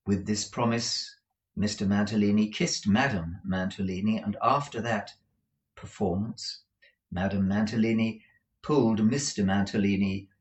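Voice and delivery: usual speaking voice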